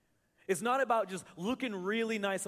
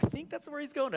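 mock timid congregation; 8 semitones higher, 3 dB louder, faster than usual. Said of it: "kinda said it quietly".

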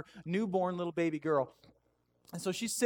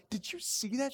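high, softened, eager question; retold excited church-pew aside; 6 semitones higher, 5 dB quieter than usual. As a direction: act out nudging someone in hushed amazement. Acts nudging wife in church.

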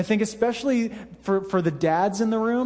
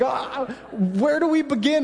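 laughing dismay; 6 semitones higher than usual. Retold or imitated. Facing the mess in tent.

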